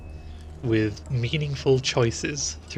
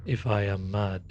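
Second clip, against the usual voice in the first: low, softer ominous reading; scary voice; 4 semitones lower, 4 dB quieter than usual. Acted. Streamer reading a horror game's opening quote, scary voice. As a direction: read it low and eerie, fading at the end. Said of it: "That was my scary voice".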